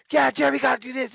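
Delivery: high, loud, fast instructions; yelling coach. Retold or imitated. Coach shouting instructions at Jeremy from the corner, quick mock yell. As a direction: rattle off orders from the corner.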